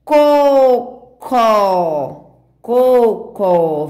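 COCÓ split into CO... CÓ; leitura silabada. Shown as a demonstration. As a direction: sound out both syllables slowly for children. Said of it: "Temos aqui agora a palavrinha". (leitura pausada, sílaba por sílaba)

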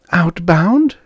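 high-pitched female query; girl querying. A narrator's reading. Waitress asks the trucker where he's headed, querying. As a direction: ask it in a high young woman's voice. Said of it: "she queried".

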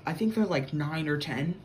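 ordinary talk

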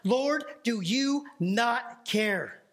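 drawn out, each word weighed; slow, heavy delivery of the famous line; slow dramatic reading. Retold or imitated. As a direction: linger on Lord like a lament. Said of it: "she says this famous line".

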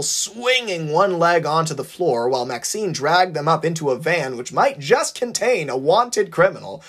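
plain reading voice

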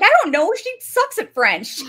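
mock-exasperated, high and loud; exasperated outburst. [name], asked to translate French, protests in mock exasperation.

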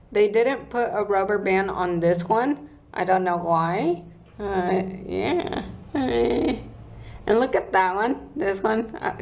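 talking with lip pulled down; speaking while showing braces; finger in mouth. Real, not acted. Seen 0: Fingers pull lips and cheek back to show braces while talking.

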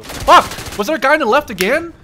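loud, high angry outburst; high, fast, frustrated shout; startled yell. Real, not acted. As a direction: yell it as you get caught off guard. Shot by surprise enemy.

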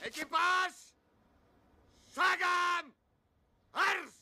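high, shouted marching orders; drill command. Acted.

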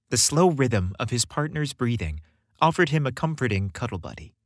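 usual speaking voice

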